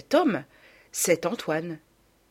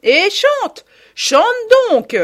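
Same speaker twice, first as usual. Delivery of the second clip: much higher, louder scolding; scolding shout; 12 dB louder, much slower than usual. A narrator's reading. Mother scolds husband before the guests, loud shout. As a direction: boss him into singing.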